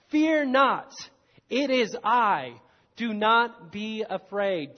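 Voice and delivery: loud, slowing call of reassurance; Jesus calling out. Acted. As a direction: call out firmly, drawing the words out. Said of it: "Jesus calls out to them".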